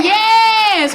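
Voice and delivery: high, loud mock yell of yes. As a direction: bark it back like the heckler.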